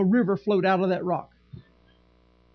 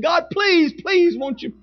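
loud, high mock begging; mock whining prayer; 7 semitones higher, 5 dB louder, slower than usual. Pastor mimicking a whining altar prayer, mock pleading. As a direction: beg God like a spoiled child. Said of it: "whining and crying up at the altar".

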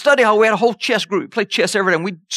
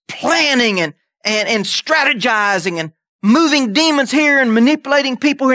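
normal talking voice

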